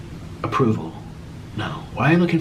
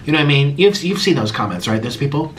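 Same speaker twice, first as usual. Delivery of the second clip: ordinary talk, nothing marked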